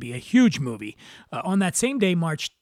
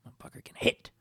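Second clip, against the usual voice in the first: whisper; 9 dB quieter, faster than usual. Acted. Crude aside about McGregor.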